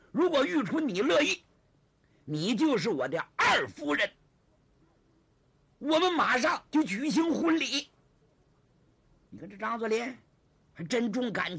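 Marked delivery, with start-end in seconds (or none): 0.1-7.9 loud, high, resolute declaration; acted ringing vow; emphatic proposal. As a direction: declare the promise with theatrical force. Zhang proposes marriage, emphatic.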